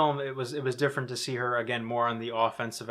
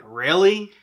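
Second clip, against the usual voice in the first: loud, disbelieving "Really?"; incredulous; 5 semitones higher, 9 dB louder than usual. Retold or imitated. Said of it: "and I'm like".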